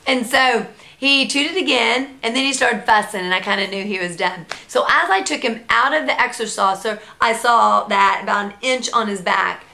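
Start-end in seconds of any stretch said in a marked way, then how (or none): none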